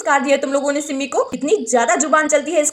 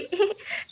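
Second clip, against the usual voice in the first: soft embarrassed little laugh; quiet laugh at herself; 4 semitones higher, 10 dB quieter than usual. Acted. Radha laughing softly at her silly dream.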